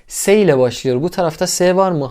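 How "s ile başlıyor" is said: usual speaking voice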